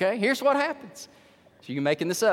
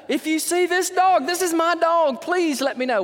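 much higher and louder, begging for his dog back; desperate plea; 11 semitones higher, 6 dB louder than usual. Retold or imitated. Preacher voicing his brother's flyer to the neighborhood, high desperate plea. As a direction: cry out the flyer as if the dog is your own.

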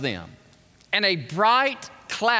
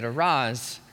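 gentle command; 8 semitones lower than usual. Acted. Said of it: "he touched them and said".

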